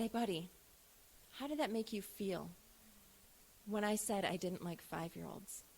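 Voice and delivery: soft, low, tender questioning; gentle questioning. Retold or imitated. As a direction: ease into it gently like comforting a child. Mother gently questioning son after.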